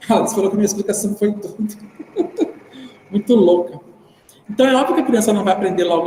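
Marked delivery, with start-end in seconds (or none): 1.6-2.5 real breathy chuckle breaking into the sentence; amused laugh. Real, not acted. Teacher told their explanation was crazy, amused laugh. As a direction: laugh mid-sentence, unable to finish.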